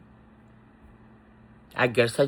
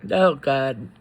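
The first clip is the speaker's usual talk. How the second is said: ordinary talk, nothing marked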